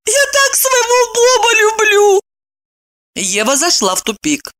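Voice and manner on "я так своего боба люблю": high, tearful wail; crying lament. A narrator's reading. Weeping Evdokia confesses love for Bob, wailing high. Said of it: "заплакала".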